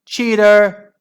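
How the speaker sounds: high, loud outraged yell; scream. A narrator's reading. Angry mum yelling at her daughter's rival, screaming. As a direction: scream the accusation. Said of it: "screamed Moody Margaret's mum".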